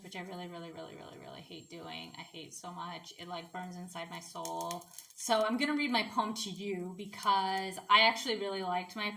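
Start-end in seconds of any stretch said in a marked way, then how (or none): 0.0-4.9 much softer and lower, muttered venting; grudging aside. Real, not acted. Admitting how much they hate group collaborations, low grudging aside. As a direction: drop into a low, quiet confession of loathing.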